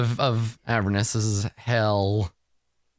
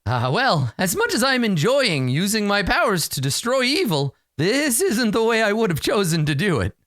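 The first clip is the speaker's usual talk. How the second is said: high, theatrical creature voice; captive unicorn; 10 semitones higher than usual. Acted. Captive unicorn explains its plight to adventurers, high theatrical voice. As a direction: lilt it high, like a storybook unicorn. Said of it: "nods sadly and, uh, and says".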